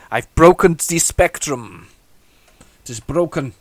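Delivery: slow, hammed-up German accent; a mock German accent over the broken spectrum. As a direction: play it like a comic German. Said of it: "I went into German there".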